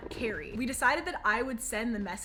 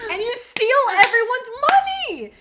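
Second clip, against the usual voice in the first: higher, louder indignant accusation; angry yell; 9 semitones higher, 12 dB louder than usual. Retold or imitated. Retelling the message to scammer friend, angry yell. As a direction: hit her with the accusation.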